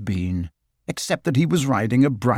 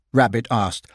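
plain reading voice

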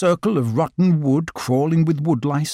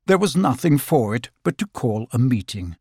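ordinary delivery

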